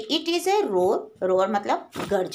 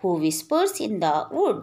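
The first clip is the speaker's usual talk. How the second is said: slow poem recitation; 4 semitones lower, much slower than usual. Acted. Teacher reading the poem line to her class, slow and measured. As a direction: draw out each word slowly, recitation style.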